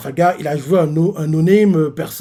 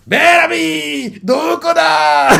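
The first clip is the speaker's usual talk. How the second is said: high, slow drawn-out call; anime-style stretched call; 11 semitones higher, much slower than usual. Retold or imitated. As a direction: shout his name across the town. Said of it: "Il dit ça comme ça".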